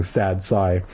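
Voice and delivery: ordinary delivery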